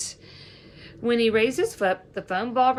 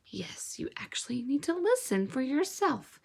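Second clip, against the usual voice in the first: whispering; 5 semitones higher, 5 dB quieter than usual. Real, not acted. Teacher aside to listeners.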